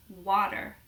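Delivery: "water" in American, "wah-der"; American "water" with open vowel and final R; American accent. Shown as a demonstration. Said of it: "they pronounce it very differently".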